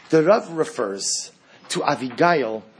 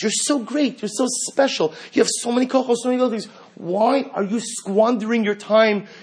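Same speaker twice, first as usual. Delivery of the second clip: high, pleading voice, coaxing and scolding at once; pleading Abigail; 6 semitones higher than usual. Acted. Abigail gently scolding the listener, high and pleading. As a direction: beg a beloved one to stop wasting himself, voice lifted high.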